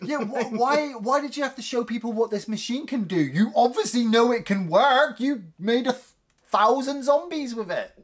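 high-pitched incredulous rant; pleading, high-voiced mock complaint; mock exasperation. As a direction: scold him in squeaky disbelief. Mock-scolding the machine's inventor.

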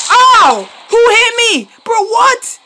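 high, loud yelps of outrage at dying; shocked outcry. Real, not acted. Player killed suddenly, yelling in shock. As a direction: cry out stunned at being hit.

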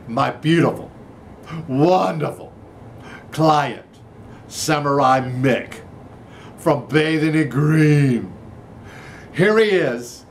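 building showman introduction; hype announcer. Acted. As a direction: sell the guest to the crowd. Hypes up guest like an announcer.

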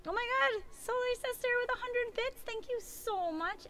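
high-pitched, delighted surprise; squealy, grateful burst of excitement; excited thanks. Real, not acted. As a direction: gush over an unexpected gift. Thanking a viewer for surprise gift.

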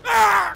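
a loud yelp as the plane crashes; startled yell. Real, not acted. Gamer crashing his warplane, startled yell.

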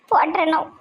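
normal talking voice

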